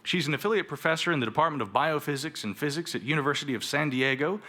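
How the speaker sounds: normal talking voice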